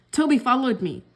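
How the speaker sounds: ordinary delivery, nothing marked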